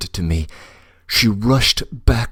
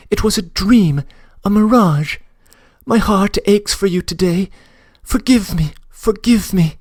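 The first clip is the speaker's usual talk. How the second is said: put-on higher, tearful young woman begging forgiveness; Nastenka pleading; 13 semitones higher, 4 dB louder than usual. Nastenka's letter begging her friend's forgiveness, pleading higher voice. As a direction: plead with him as a heartbroken girl, higher.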